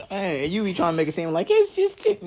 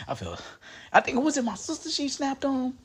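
normal talking voice

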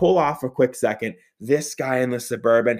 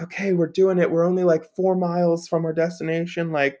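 raised, upbeat pitch rising to a high woo; excited cheering; 5 semitones higher than usual. Retold or imitated. Driver hyping themselves while merging into the lane, excited. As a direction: cheer yourself on as you set off.

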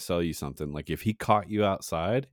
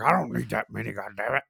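higher-pitched cranky impression; complaining old man; 4 semitones higher than usual. Old customer complaining about too many packets, imitated crankiness. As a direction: grouse like an irritated old regular. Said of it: "he would complain about that".

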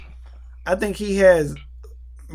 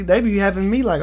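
normal talking voice

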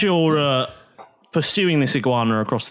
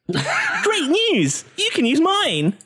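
giggly high anime hostess; 14 semitones higher, much slower than usual. Acted. Aasimar hostess offering pen.

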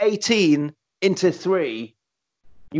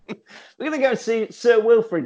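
higher, hammy heroic announcement; mocking hero voice; 6 semitones higher than usual. Retelling a film to his co-host, mocking hero tone. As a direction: ham up the hero's big plan. Repeats the line in character.

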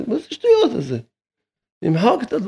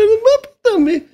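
high mocking voice; slower than usual. Rabbi scoffing at those arguing over two hundred shekels, high and mocking. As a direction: jump way up into a high, incredulous mocking pitch. Rabbi voicing his imagined reply.

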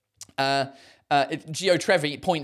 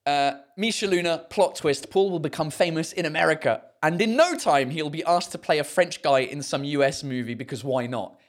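normal talking voice

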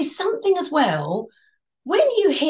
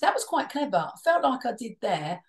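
normal talking voice

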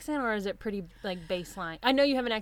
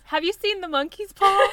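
much higher, laughing; mock title question; 9 semitones higher, 5 dB louder than usual. Cohost suggesting a title, high and laughing. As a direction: pitch it like a cheesy tagline. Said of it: "Or just".

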